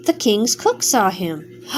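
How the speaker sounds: ordinary delivery, nothing marked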